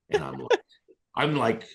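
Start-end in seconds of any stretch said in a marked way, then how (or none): none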